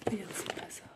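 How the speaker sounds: under-breath whispered merde; frustrated whisper; whispered curse. Real, not acted. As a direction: hiss it to yourself, annoyed. Muttered curse on missing card.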